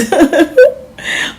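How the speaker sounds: high delighted laugh; excited giggle. Acted. Kid giggling over the pizza bombs, delighted laugh. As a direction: giggle with glee.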